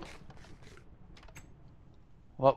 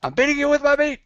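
triumphant yell; 12 semitones higher, 5 dB louder than usual. Real, not acted. Triumphant yelling in fight.

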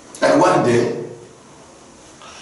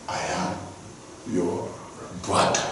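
soft, broken, almost breathless reveal; tearful near-whisper; 7 semitones lower, 11 dB quieter than usual. Acted. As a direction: barely voice it, choked with tears. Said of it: "And Joseph started crying".

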